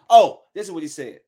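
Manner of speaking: usual speaking voice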